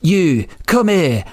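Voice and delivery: high, drawn-out mock Scottish accent; bad Scottish accent. Host mimicking the actor easing into his accent, bad Scottish accent. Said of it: "doing a Scottish accent".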